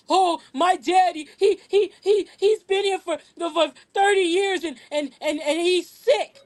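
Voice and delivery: mock a pleading, stammering crier, drawing words out; mock crying.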